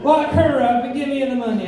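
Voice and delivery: ordinary talk, nothing marked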